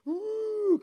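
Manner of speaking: high, loud, held "ooh"; mock teenage wail. Preacher mimics teenager falling apart in back, mock wail. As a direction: imitate a worked-up teenager wailing. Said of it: "guess what the teenager did?".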